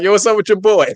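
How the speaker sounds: imitating his friend. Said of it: "he gonna be like".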